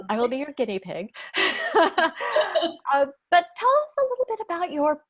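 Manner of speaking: laughing through the words, pitched high. Real, not acted. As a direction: giggle at the joke, then ask on. Host jokes about being guinea pig.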